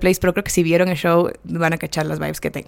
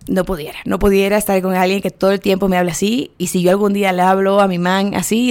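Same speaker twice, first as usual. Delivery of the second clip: ordinary delivery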